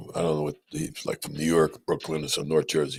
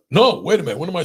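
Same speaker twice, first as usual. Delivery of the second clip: higher, louder "wait a minute"; sudden loud correction; 10 semitones higher, 6 dB louder, faster than usual. Real, not acted. Surgeon catching his own slip mid-story, loud self-correction. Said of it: "I didn't mean to yell".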